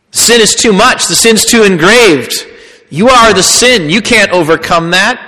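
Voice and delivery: a hint of a taunting tempter; voicing the lie. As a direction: throw the accusation like the tempter.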